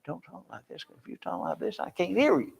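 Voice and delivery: barely audible murmur, swallowed words; mumbling too softly. Shown as a demonstration. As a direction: show how not to talk, almost inaudible. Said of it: "you have to speak up".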